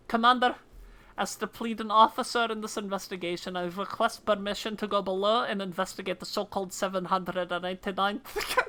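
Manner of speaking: dutiful officer in a put-on Scottish accent; fake Scottish accent. Officer asking the commander's permission to investigate, fake Scottish accent. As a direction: play a Scottish officer making a request. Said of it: "fake Scottish accents".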